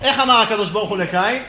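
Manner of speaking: ordinary delivery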